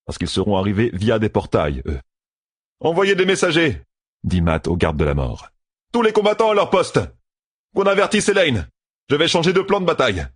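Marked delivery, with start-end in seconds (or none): 2.8-10.3 voix haute et forte, ordres rapides; ordres pressants. A narrator's reading. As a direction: crier des ordres au camp. Matt orders, urgent commands. (Matt aboie des ordres à la garde)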